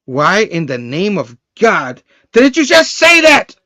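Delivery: slow build to a loud, high yell; mock exasperation, drawn out then shouted; exasperated outburst. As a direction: build word by word into a comic shout. Acted exasperated outburst of Spidey.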